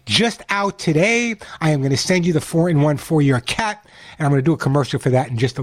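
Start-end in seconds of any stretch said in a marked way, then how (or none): none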